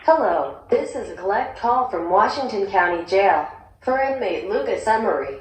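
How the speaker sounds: usual speaking voice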